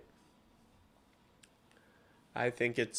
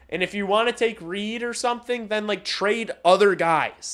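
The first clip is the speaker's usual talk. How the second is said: loud, higher heated ranting; 8 semitones higher, 10 dB louder than usual. Real, not acted. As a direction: push the point hard at the chat. Heated trade rant.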